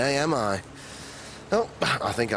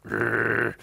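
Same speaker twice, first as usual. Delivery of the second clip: growl. Acted. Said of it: "Eb growled".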